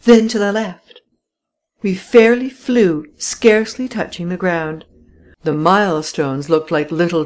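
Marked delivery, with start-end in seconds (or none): 0.0-1.0 high, louder called direction; cried out. A narrator's reading. Companion directing the racing driver, loud high cry. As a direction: call the next turn urgently. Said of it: "cried Delivet".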